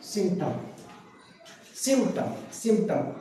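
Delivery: सिम्टम said sim-tum, p left out; drilling the word for students; silent p. Shown as a demonstration. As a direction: repeat सिम्टम slowly with no p. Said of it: "इसको सिम्टम बोल रहे हैं".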